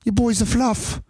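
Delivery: put-on teasing English-accented jeer. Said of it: "Oh, it's English, I guess".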